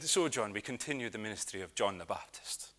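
soft, low Hebridean lilt; attempted Lewis accent. Retired minister to trainee John, attempted Lewis accent. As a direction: try an old Lewis minister's accent, quietly. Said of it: "try and do the accent".

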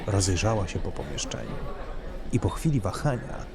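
usual speaking voice

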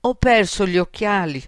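plain reading voice

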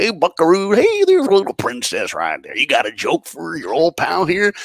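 high, hearty hammed-up cowboy voice; cowboy host cooing at a little princess; cowboy emcee. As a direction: greet the little girl like a cheery cowboy host. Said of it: "The guy's like".

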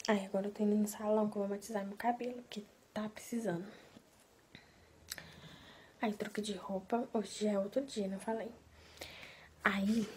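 quiet. Real, not acted. Speaks very quietly.

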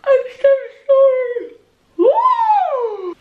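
high, loud yelp then a whoop; sudden shriek. Real, not acted. Artist yelping at a mistake, then whooping. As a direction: shriek it out over a slip. Mishap while drawing, crying out.

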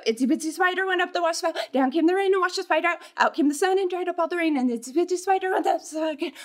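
fast itsy bitsy spider sing-along; really fast. Shown as a demonstration. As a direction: race through the song. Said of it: "we're going to do it really fast".

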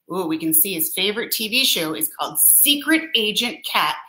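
ordinary delivery

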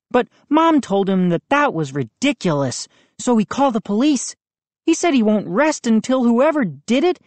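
worried friend explaining, lightly played; boy Moose. A narrator's reading. Moose voiced by narrator.

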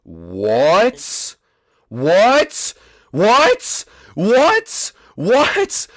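mounting high-pitched bafflement; escalating disbelief. Real, not acted. Repeated disbelief at the replayed scene.

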